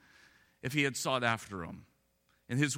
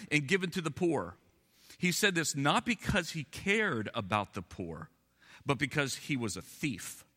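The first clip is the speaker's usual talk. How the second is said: solemn, measured Bible reading; scripture reading; 3 semitones lower than usual. Acted.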